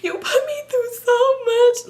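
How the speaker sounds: high, slow mock wail; exaggerated bawling, hard to understand; crying voice. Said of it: "Let me talk regular".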